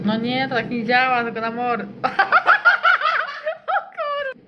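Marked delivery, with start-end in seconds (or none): 1.9-3.6 high squealing laugh. Real, not acted. Laughing at photo. 3.7-4.4 high, cut off by laughter; laughing. Real, not acted.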